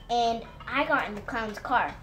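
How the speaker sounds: ordinary delivery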